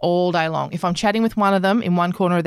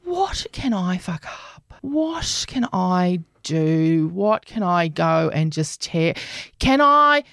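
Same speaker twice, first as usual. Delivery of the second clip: slow, whispered scheming cat thoughts; 2 semitones lower, much slower than usual. Pretend. Owner voicing her mischievous cat's thoughts, slow scheming whisper. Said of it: "I can just tell she's thinking".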